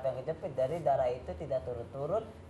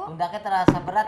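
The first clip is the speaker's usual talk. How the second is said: louder for a caller who can't hear; speaking up; 4 semitones higher, 9 dB louder than usual. Real, not acted. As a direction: raise the voice so the caller hears.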